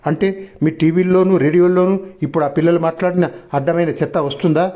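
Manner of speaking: ordinary delivery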